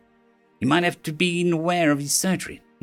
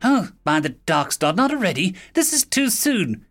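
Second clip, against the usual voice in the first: alarmed; 6 semitones higher than usual. Acted. Nurse alarmed at human waking.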